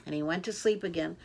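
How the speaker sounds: ordinary delivery, nothing marked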